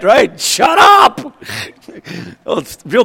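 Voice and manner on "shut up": high loud snap; crowd shouting. Acted. Crowd yelling at the blind men to shut up. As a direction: shout them down, annoyed.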